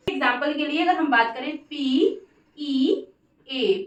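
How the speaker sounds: normal talking voice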